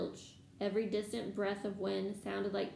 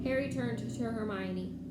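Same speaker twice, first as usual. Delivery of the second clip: usual speaking voice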